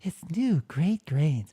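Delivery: slow, lightly breathy imitation of the cereal ad; a hint of a whispery ad voice; mocking the ad voice. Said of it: "worst D L I've ever heard".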